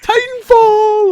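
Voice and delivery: high, loud, drawn-out mock squeal; mock excited cheer. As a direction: stretch it out in over-the-top excitement. Mock-bragging to friend over a stream.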